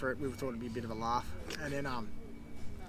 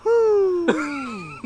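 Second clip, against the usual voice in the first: much higher, louder whoo with a laugh; loud stoned whoop; 12 dB louder than usual. Retold or imitated. Stoned friend on stage letting out a giddy whoop. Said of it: "And he goes".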